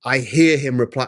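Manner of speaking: usual speaking voice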